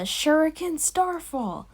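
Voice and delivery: high, slow anime move announcement; mock-heroic attack cry; imitated attack call. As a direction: call the special move, stretched out. Calls out character's attack name.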